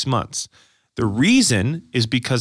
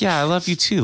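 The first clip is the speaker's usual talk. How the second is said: imitating wife; 8 semitones higher than usual. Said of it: "she's just like".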